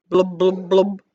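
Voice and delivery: playful simmer noises; bubbling blub. Acted. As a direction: let the soup bubble in your voice. Voicing simmering soup sound.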